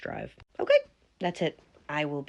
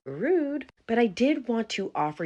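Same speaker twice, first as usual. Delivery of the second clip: snotty; 8 semitones higher, 4 dB louder than usual. Acted. Snotty boss's daughter.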